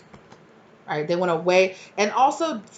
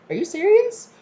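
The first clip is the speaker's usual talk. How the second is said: high-pitched, louder outraged disbelief; shocked disbelief; 5 dB louder than usual. Retold or imitated. Reader reacting to a friend's betrayal, shocked high cry.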